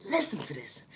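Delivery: hushed whisper to draw them in. Acted. Whispering to the audience before the dog story. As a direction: lean in and whisper it.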